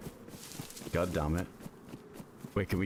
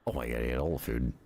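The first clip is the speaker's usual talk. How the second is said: ordinary talk, nothing marked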